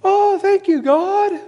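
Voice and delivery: high, loud squeal of delight; mock gleeful thanks. Preacher mocking his own greedy glee at the extra change. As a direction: gush like you found free money. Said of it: "it was like".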